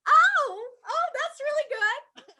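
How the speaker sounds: very high little voice; retold taken-aback reaction. Actress told she is the funny one, high-pitched mock reaction. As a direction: squeak it out, acting taken aback.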